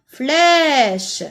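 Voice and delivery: FLÈCHE said loud with a long open è. Shown as a demonstration. Teacher lets pupils hear the accent grave, long open è in Flèche. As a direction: draw out the è in Flèche, loud and clear. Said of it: "J'entends E avec accent grave".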